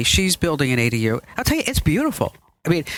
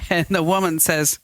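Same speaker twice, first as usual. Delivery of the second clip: usual speaking voice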